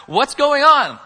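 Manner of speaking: loud, high question over a crowd; blind man calling out. Acted.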